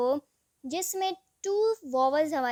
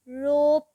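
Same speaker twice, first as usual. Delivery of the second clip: रोप said loudly, the ओ stretched long; loud, emphatic teacher demo of रोप; stressed word; 8 dB louder, much slower than usual. Shown as a demonstration. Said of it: "उस पे emphasise हो जाता है".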